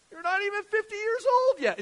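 very high, loud squawk; hammed-up incredulous yell; mock disbelief. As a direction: play a scandalized heckler. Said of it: "It's like they haven't even heard him".

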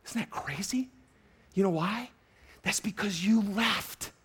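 hushed whisper. Real, not acted. Pastor marvelling to congregation, whispered. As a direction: breathe it in awe.

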